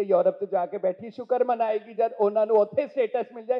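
fast, loud gossiping auntie; Punjabi auntie voice. Acted. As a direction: rattle it off like a nosy Punjabi aunt. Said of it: "if you ask Pammi auntie".

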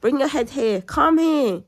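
playful coaxing. Pretend. Playful temperature check.

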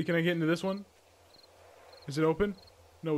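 normal talking voice

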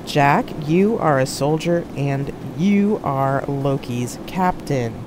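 slow, deliberate stress on "you are"; emphasizing the words. A narrator's reading. Poe reminding Jack "you are Loki's captain", slow emphasis on each word. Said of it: "emphasizing the words".

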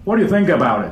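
firm, full-voiced English question; big clear voice, falling at the end; projected so all hear. Shown as a demonstration. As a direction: project it to the back of the room. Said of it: "って言わないと".

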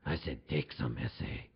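very low federale threat; mock menacing cop. Cop with a switchblade orders him, low mock menace.

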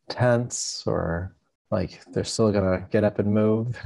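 calm, unhurried meditation guide with long pauses; guided meditation. Acted. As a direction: ease listeners into stillness, leaving space between phrases.

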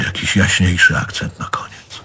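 whispered aside. Acted. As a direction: breathe it out with no voice. Quiet aside at the end of the scene.

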